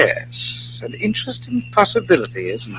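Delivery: conspiratorial hush. Acted. Conspirators eavesdropping on plans.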